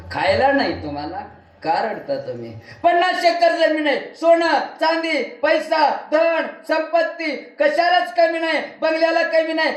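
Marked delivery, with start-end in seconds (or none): 2.8-9.7 lightly voiced old man, pitched higher, listing his riches; rich old man complaining. Old man tells the preacher why he weeps, voiced higher. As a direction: play the old farmer counting his wealth through tears, a bit higher.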